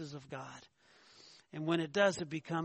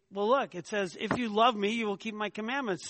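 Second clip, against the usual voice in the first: mildly acted higher, louder argument; insistent objection; 4 semitones higher, 6 dB louder than usual. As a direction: voice an objector pointing at proof. Acts out people arguing from scripture.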